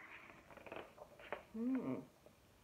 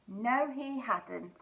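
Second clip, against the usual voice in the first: plain reading voice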